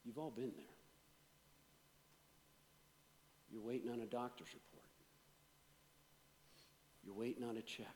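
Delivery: low, soft pastoral voice; hushed, tender close of a sermon; intimate soft preaching. Real, not acted. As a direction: speak gently to each hurting listener. Soft intimate closing appeal.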